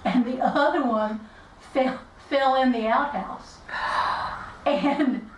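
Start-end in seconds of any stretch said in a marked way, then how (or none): 3.7-4.6 sharp breathless gasp. Retold or imitated. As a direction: gasp in shock. Rooster fell in outhouse.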